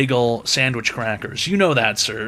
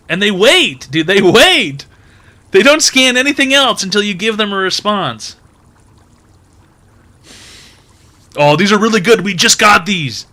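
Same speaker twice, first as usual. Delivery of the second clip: louder, higher, worked-up insistence; exasperated rant; 6 semitones higher, 6 dB louder than usual. Acted. Comedian rants to the crowd, exasperated. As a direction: pile on the frustration.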